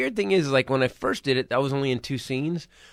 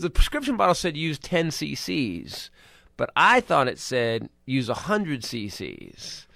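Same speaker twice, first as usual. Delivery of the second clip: slow deadpan dad; 4 dB quieter, slower than usual. Retold or imitated. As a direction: draw it out slowly. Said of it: "He goes".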